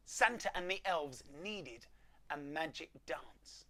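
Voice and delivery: ordinary delivery, nothing marked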